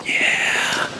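soft unvoiced yeah in the dark; nervous night vlog whisper. Real, not acted. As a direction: breathe it nervously, barely voiced. Night exploration, unvoiced reply.

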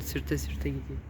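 stuffy nose from a cold; sniffling. Real, not acted. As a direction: talk with a sniffly sick nose. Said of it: "burnumu çekiyorum". (burnu tıkalı, nezleli)

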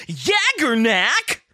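high, very slow, drawn-out dub-actor cry; English dub voice. Retold or imitated. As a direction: ham up Yaggernak like an overacted anime dub. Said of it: "the dub's like".

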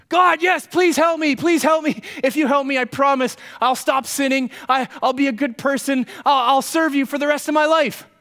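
high, loud, frantic begging; desperate pleading. Acted. Jack bargains with God from the cliff, desperate pleading. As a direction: beg and promise anything to be saved.

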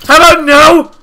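high, very loud refusal; panicked yelling. Real, not acted. Mobs attack in the game.

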